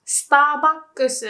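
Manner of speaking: Starbucks as sutaabakkusu, with a final su; slow, full Japanese form of Starbucks; Japanese pronunciation. Shown as a demonstration. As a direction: spell out Starbucks in Japanese syllables. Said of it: "Starbucks is correct".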